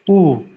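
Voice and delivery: a loud, long oo like double O; loud teacher's oo; Russian oo. Shown as a demonstration. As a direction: say oo loud and round. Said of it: "This is the oo sounding in Russian".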